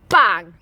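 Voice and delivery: loud door slam sound; loud bang effect. Acted. Door slammed in story.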